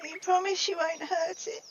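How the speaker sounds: put-on high child voice asking timidly; a small kid checking you won't hurt her toy; timid child pleading; worried little girl.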